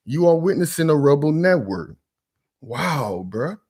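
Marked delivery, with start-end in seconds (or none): none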